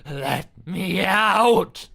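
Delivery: a trapped soul screaming to get out; panicked scream. Acted. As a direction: scream to be freed.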